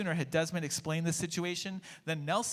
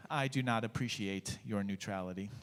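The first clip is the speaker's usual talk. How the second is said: sighing; 6 semitones lower than usual. A narrator's reading. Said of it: "Desmond sighed".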